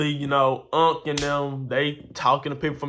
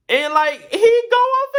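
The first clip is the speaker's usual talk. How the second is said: much higher and louder, disbelieving; exasperated; 8 dB louder than usual. Real, not acted. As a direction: let the outrage burst out. Exasperated about uncle yelling again.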